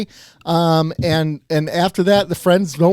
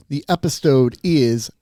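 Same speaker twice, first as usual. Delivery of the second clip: normal talking voice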